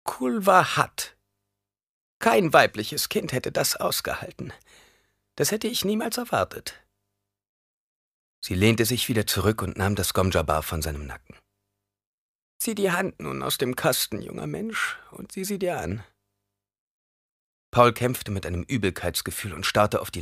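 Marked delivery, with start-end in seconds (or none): 0.0-6.8 put-on high old crone voice; old woman marvelling, pitched high; amazed old woman. A narrator's reading. Old woman marvels at the boy's endurance, high voice. 12.6-16.0 high, soft crone voice; old woman instructing, high and quiet; commanding old woman. A narrator's reading. Old woman tells the boy to withdraw his hand, high and soft.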